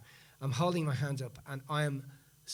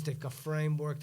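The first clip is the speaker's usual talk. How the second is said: normal talking voice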